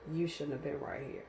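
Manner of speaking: hushed, sober retelling; grave warning, retold. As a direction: drop the volume to warn her as the driver. Tow driver gravely warning her.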